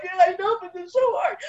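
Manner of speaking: a touch of a helpless complaint; mock whiny protest. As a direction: whine it like a tired kid. Her own whiny reply, quoted.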